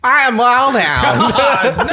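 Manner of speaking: slow, high, loud drawl laughing; drawled intro. Acted. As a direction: belt the name out slowly, cracking up. Redoing the hound intro.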